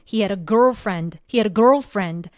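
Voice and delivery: put-on slow, "he" and "girlfriend" pressed; stress on he and girlfriend. Shown as a demonstration. Teacher marking the stressed words, slow stress on "girlfriend". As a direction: push "he" and "girlfriend" out. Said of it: "강세는".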